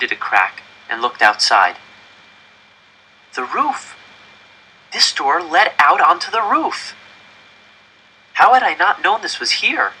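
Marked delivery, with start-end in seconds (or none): 3.4-9.9 high, breathless wonder at the find; boy amazed he never knew this door; excited discovery. A narrator's reading. Boy finding the rooftop, excited and amazed.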